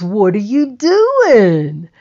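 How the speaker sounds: lightly voiced man asking; a touch of Bill's voice; Bill on the phone. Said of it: "And he goes".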